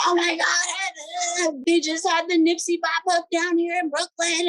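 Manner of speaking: high, gushing imitation of an excited caller. Brooklyn lady calling the artist, imitated as a high excited gush. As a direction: gush like a thrilled fan on the phone. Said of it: "she was like".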